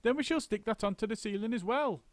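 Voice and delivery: high instructing monkey; high brisk monkey foreman; bossy monkey. A narrator's reading. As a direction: plan it out quickly. Mugglewump's orders.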